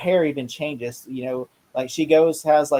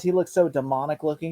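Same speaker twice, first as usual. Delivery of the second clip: usual speaking voice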